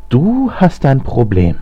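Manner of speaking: drawn-out high mocking jeer on "Du"; mocking, slow and incredulous. A narrator's reading. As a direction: scoff in disbelief, stretching "Du" up high. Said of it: "höhnte Alexander".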